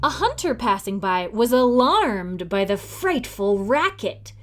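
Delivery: ordinary delivery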